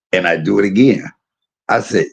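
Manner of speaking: usual speaking voice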